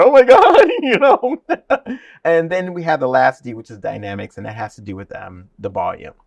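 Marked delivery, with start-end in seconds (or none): 0.0-2.0 high, loud excited gasp; excited students. Retold or imitated. Teacher imitating students thrilled at their new sound, high and loud. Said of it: "they get excited".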